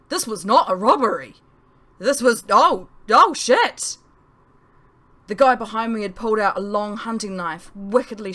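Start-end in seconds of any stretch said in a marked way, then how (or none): 0.1-4.0 loud, rising panic at knifepoint; loud frightened outburst, higher; panicked shout. Acted. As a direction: shout it in sheer terror. Realising it's a murder.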